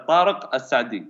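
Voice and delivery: "Tariq Al-Sadi" in native Arabic, the l melting into the s; "Tariq Al-Sadi" as an Arabic speaker says it; native Arabic pronunciation. Shown as a demonstration. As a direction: model "Tariq Al-Sadi" with authentic Arabic sounds. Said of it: "Say again?".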